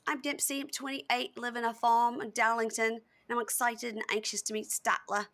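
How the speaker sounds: imitating a British woman with an amateur accent. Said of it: "that's my British accent".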